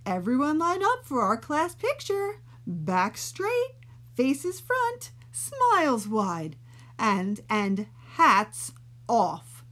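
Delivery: a teacher lining up the class; cheerful teacher. Acted.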